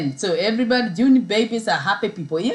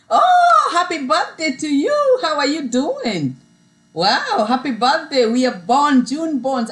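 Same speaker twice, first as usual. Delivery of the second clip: loud, high-pitched "Oh!" bursting into congratulations; delighted birthday greeting; 7 semitones higher, 4 dB louder than usual. Real, not acted. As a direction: greet a fellow birthday-month viewer with real excitement. Greets a fellow June-born viewer.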